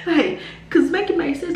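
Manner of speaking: high laugh into words. Real, not acted. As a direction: laugh it off in disbelief. Laughing in disbelief.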